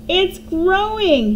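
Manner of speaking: put-on high, slow boyish excited yell. A narrator's reading. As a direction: call out in amazement. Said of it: "he yelled".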